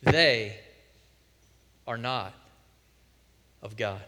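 a grave, sinking pronouncement; slow stark declaration. Shown as a demonstration. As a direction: let each piece of "They are not of God" fall heavily. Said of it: "emphatic declarations".

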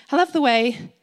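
usual speaking voice

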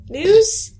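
high loud call out. Pretend. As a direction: call out hopefully into silence. Calling out louder for news.

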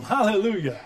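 ordinary delivery

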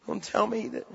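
soft warning with long pauses; hushed, intense preaching. Real, not acted. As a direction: drop the volume and let it weigh. Preacher drops after shouted climax.

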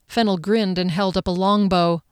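ordinary delivery

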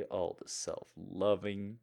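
ordinary delivery

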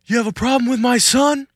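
high, loud Italian-American challenge; Italian dad voice. Retold or imitated. Dad threatens the attacker in an Italian accent. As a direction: confront him like a mafia dad. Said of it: "in the Italian voice".

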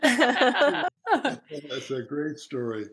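slow, laughing through the words; real laugh at his own tale. Real, not acted. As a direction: chuckle it out fondly. Laughs after telling the story.

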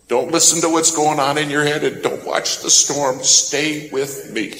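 urgent plea. Retold or imitated. Preacher repeats the voice's plea.

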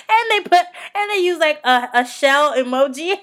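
high, loud, laughing delivery; cracking up. Real, not acted. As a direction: get it out through real laughter. Cracking up at the post.

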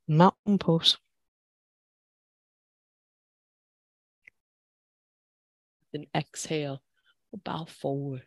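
calm, steady class cues; yoga instructor voice. Acted. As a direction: guide the flow evenly.

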